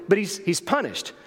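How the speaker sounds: normal talking voice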